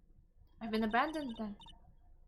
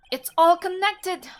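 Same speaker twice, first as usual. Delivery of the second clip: loud, slow, high cry of discovery; excited realization; 6 semitones higher, 14 dB louder, slower than usual. Acted. Lawyer realizes the clues connect, crying out slow, high and loud. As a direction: shout out the breakthrough slowly.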